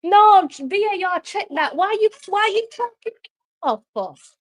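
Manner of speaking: high-pitched, disbelieving demand at the referee; outraged protest. Real, not acted. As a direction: plead with VAR, pitch up high. Outraged at the VAR call.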